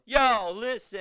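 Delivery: high, loud "Yo, listen" to the crowd; hype call. Retold or imitated. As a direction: grab the crowd's attention. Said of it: "And he's, you know".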